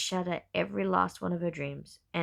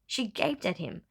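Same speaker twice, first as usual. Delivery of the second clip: ordinary delivery, nothing marked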